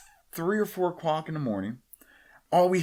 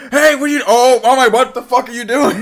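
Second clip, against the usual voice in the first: very high, loud panicked outburst; mock startled shouting; 11 semitones higher, 13 dB louder than usual. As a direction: play someone walking in on a shocking sight. Imagines surprising a man urinating.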